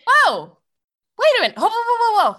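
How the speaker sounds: shocked whoa. Real, not acted. Shocked at price hike.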